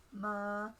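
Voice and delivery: "ma" held level at mid pitch; level, unchanging "ma". Shown as a demonstration. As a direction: say "ma" on an even middle tone. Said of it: "You can have a mid-tone".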